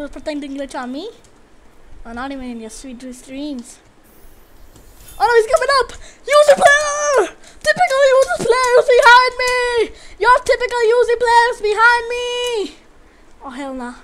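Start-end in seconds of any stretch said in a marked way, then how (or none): 5.2-12.8 high, loud alarmed yelling; yelling in panic at the Uzi players behind; panicked shout. Real, not acted. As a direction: scream the warning.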